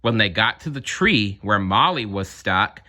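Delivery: plain reading voice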